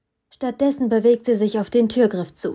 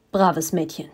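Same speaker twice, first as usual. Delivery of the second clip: patronizing; 4 semitones lower than usual. A narrator's reading. Villain patronizes her.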